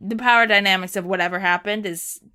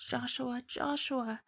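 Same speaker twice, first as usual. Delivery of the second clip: fading plea; 3 semitones higher, 14 dB quieter, much slower than usual. Acted. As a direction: call after him faintly while drifting off. Estelle calls out while losing consciousness.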